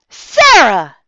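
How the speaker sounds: loud single sharp name call; sharp rebuke. A narrator's reading. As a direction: cut her off mid-sentence. Father cuts daughter off.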